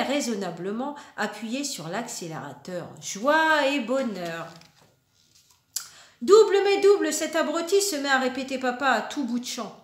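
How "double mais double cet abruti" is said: grumpy dad. Acted. Father voiced grumbling in story.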